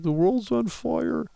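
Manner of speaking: high, slow mock-whiny kid voice; mocking crying child. Mocking a twelve-year-old on TV, high mock wail. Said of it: "crying like a fucking baby on T V".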